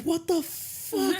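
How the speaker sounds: high, drawn-out mock freak-out; mock panic. Retelling the comic heroine finding a foot, in mock panic. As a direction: stretch it out high, like a character panicking. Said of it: "So she starts panicking".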